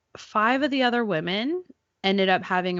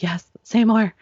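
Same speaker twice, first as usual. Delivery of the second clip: eager begging; 4 semitones higher, 8 dB louder than usual. Retold or imitated. Begging nurse to keep encouraging.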